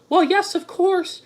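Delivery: high-pitched put-on woman's voice; put-on falsetto girlfriend; mock girlfriend voice. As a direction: read her text in a mocking high register. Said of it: "She goes".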